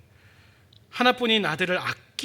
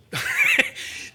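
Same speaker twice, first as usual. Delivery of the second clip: burst of laughter. Real, not acted. Laughs at his own joke.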